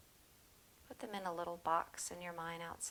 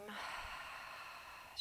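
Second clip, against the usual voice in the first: soft breathy sigh out through the mouth; audible exhale sigh; 3 semitones higher, 9 dB quieter than usual. Shown as a demonstration. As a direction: show the class a long letting-go exhale. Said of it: "Let it go out the mouth this time".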